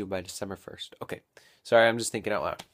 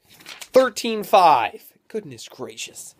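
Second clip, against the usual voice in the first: loud, high, drawn-out shout easing into slow exasperation; mad outburst; 8 semitones higher, 11 dB louder, much slower than usual. Real, not acted. Vlogger finally finding the elusive verse reference, exasperated. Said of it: "gets mad when they read a Bible".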